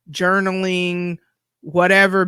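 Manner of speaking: ordinary talk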